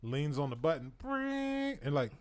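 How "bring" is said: high trilled ringing noise; sustained high-pitched brrring; buzzer imitation. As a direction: imitate a service bell ringing. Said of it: "it rings in the back".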